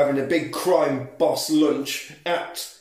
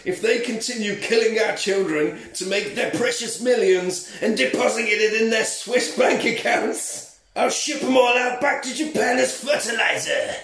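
higher-pitched mock-angry movie speech; hammed-up tirade, pitched up and building; angry rant; 6 semitones higher than usual. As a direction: act out an outraged action-hero threat. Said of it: "he gets more angry and he says".

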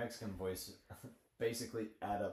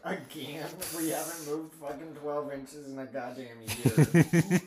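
exasperated soldier yell; 6 semitones higher, 10 dB louder than usual. Retold or imitated. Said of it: "you're like".

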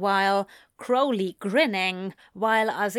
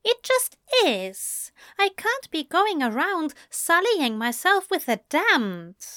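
higher angel voice, indignant protest; prim angel, flustered; 10 semitones higher than usual. Acted. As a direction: refuse primly as a scandalised angel. Voices Aziraphale in dialogue.

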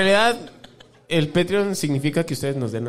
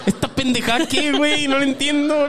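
mock baffled reader; 7 semitones higher than usual. Mock puzzled reading aloud.

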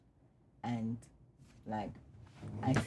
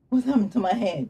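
shocked; 9 semitones higher, 7 dB louder than usual. Retold or imitated.

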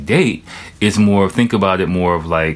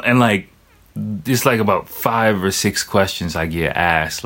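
normal talking voice

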